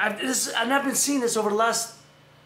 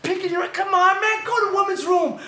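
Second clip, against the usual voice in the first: high, raised-voice protest; worked-up telling-off; exasperated scolding; 10 semitones higher, 3 dB louder than usual. Acted. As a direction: plead loudly in disgusted disbelief. Scolding woman he saw in public.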